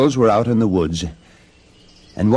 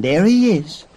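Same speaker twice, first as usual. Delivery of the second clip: spotting someone excitedly; 10 semitones higher, 6 dB louder than usual. A narrator's reading. Girl spots and points out dwarf.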